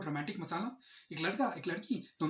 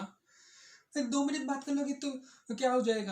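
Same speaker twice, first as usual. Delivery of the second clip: higher-pitched imitation of her plea; mock pleading girlfriend; 6 semitones higher, 3 dB louder, slower than usual. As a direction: play the girlfriend wheedling, voice lifted. Acts girlfriend pleading on call. (Girlfriend की मनुहार की नकल)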